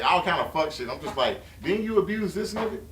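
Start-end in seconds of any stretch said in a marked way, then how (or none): 1.6-2.8 high, mocking disbelief; incredulous retort. To the girlfriend, mocking disbelief. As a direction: throw it back at her, incredulous.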